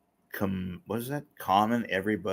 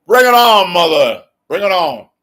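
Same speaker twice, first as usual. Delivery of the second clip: loud, high challenge yell; roaring bring-it-on taunt; defiant shout; 9 semitones higher, 14 dB louder than usual. Acted. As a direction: bellow it like a dare. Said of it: "whether you like me or not".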